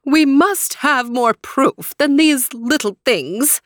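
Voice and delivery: slow, high, puffed-up exclaiming; pompous prince. Acted. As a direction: demand it like a vain prince. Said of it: "exclaimed Prince Ledra".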